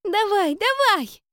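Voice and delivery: put-on very high, loud, eager child voice; delighted high-pitched cheer; joyful little girl. A narrator's reading. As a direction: urge it on with childish glee. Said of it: "Девочка радостно захлопала в ладоши".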